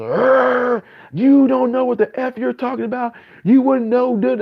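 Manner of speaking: high, loud mock rant; mock rage. Imitating an irate commenter cursing him out, high and loud mock rage. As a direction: ham up an angry outburst. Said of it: "respond with just".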